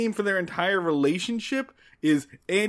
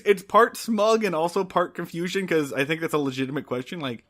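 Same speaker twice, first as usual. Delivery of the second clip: usual speaking voice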